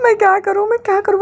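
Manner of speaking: panicky fretting. A narrator's reading. Desperate host with no model, panicky. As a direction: fret while pacing.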